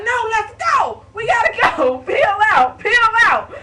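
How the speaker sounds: high, loud mock-panicked yelling; panicked screaming. Brother screaming at his sister to peel out, high panicked shouting. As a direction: yell at her to floor it and flee. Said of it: "my brother was screaming like he was getting ready to die".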